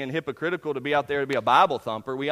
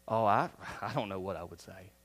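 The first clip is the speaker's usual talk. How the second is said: lower, softer mock mumble; hesitant churchgoer; 10 semitones lower, 6 dB quieter than usual. Imitating a nervous churchgoer dodging the request, mumbling low. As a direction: mumble it like a shy excuse.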